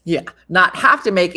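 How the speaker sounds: usual speaking voice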